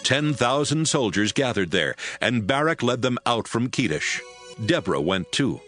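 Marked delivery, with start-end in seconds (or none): none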